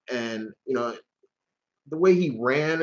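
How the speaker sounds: ordinary delivery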